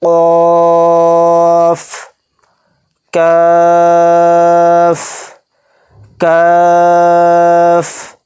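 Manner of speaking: قاف and کاف drawn out long, with a light f sound at the end; slow chanted کاف; stretched letter names. Shown as a demonstration. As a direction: hold each name for three counts. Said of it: "last میں فا یعنی English کا ایف آئے گا".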